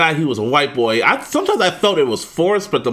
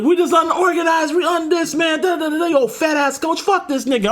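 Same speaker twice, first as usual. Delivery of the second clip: high, shouty complaining rant; mock ranting quarterback; 11 semitones higher than usual. Fed-up quarterback trashing his team, high mock rant.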